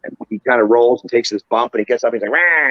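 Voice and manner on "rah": high, loud mock-feral "rah"; angry squirrel roar. Retelling a small wrestler rolling out and jumping up, angry squirrel roar. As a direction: let out a short angry critter yell. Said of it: "looks like a little angry squirrel".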